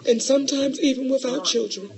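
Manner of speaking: ordinary talk, nothing marked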